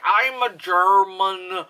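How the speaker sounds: slow, drawn-out mock German accent; bad German accent. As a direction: imitate a terribly fake German accent. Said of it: "like, German accent. It was just so bad".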